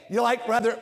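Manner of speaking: ordinary talk, nothing marked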